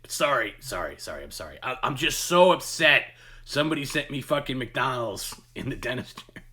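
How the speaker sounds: mock a worked-up caller apologizing, then blurting out the grievance; imitated upset yelling. Host mimics an upset caller apologizing to a bystander, imitated yelling. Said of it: "he's yelling so much".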